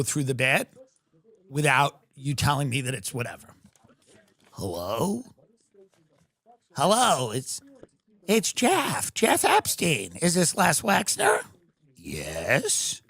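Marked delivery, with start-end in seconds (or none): 4.5-11.4 a hint of a creepy, childlike caller; lightly voiced creepy man-child on the phone; creepy childlike Epstein voice. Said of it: "weird, creepy, childlike voice". 12.0-13.0 much lower, softer gruff yes; a low, unhurried tycoon answering the phone; Wexner voice. Acted.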